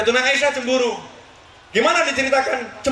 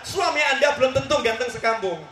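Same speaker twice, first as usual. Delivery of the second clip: usual speaking voice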